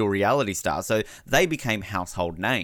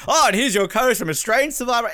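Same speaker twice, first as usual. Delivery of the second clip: host announcing; 12 semitones higher, 3 dB louder than usual. Pretend. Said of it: "If J L P was like".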